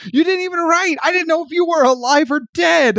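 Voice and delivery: high-pitched hammed-up shriek of reproach; imitated angry screaming. Heroine confronting the man who vanished, imitated high-pitched scream. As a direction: scream it like a wronged girlfriend. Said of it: "she's screaming at him".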